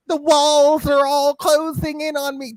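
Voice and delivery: sarcastic whimper imitating the video guy; whiny mockery. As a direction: whine it like a crybaby. Mocking whiny quote.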